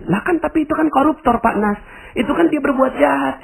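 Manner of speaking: high, indignant protest; objecting listener. Retold or imitated. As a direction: push back in disbelief. Jamaah membantah penceramah. (protes tinggi tidak terima)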